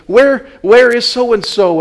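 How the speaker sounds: put-on loud, high, slow panicked call for a lost child. Panicked parent calling for child.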